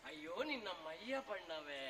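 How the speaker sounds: comic groaning taunt; mock dismay. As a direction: draw it out in mocking pity. Mocking the other's colour.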